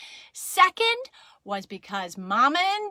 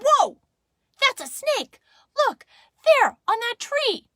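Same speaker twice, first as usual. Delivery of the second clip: high, loud alarmed shouts; startled; 9 dB louder than usual. Acted. Daddy jumps back from a snake, startled shout. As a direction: cry out in bursts at a sudden sight. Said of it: "Daddy jumped back and said".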